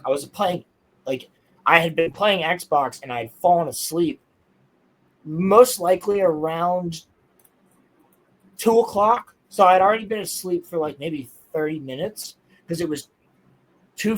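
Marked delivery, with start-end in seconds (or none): none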